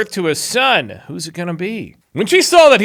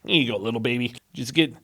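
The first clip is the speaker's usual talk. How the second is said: soft mother voice; 5 semitones lower, 8 dB quieter than usual. Acted. Mother voiced putting baby in basket.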